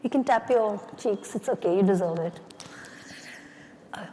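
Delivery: ordinary talk, nothing marked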